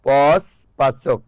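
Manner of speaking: পচ and পাচক drawn out slowly for the class; slow demonstration. Shown as a demonstration. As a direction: say পচ, then পাচক, each stretched out slowly to show the vowel change. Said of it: "teacher stretches example words". (ধীরে টেনে উদাহরণ বলা)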